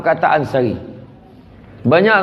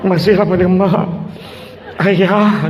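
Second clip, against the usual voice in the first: put-on emotional girl; 5 semitones higher than usual. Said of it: "kalau perempuan".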